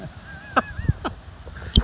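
real high-pitched burst of laughter; amused squealing laugh. Real, not acted. Teasing Robin over her greeting.

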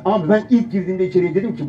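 ordinary talk

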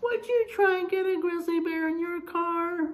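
ordinary delivery

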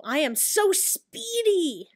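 high, slow, drawn-out gloating; playful self-admiring singsong; mock boasting. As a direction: savour every word of the brag. Brags about own speed.

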